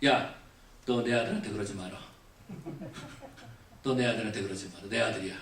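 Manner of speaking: low, restrained father's warning; father warning his child. Retold or imitated. Preacher retelling telling off his own child, low restrained warning. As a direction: draw a firm line, low and quiet. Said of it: "그래서 제가 그랬어요".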